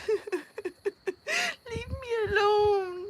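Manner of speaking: high, slow, whining through laughter; real laughing whine at a squeaking animal; laughing plea. Real, not acted. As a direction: beg the critter to go away while laughing. Animal noise during stream.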